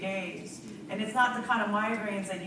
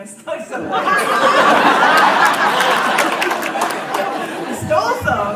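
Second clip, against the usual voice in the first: laughing; 8 semitones higher, 16 dB louder, slower than usual. Real, not acted. Admits stealing brother's shake.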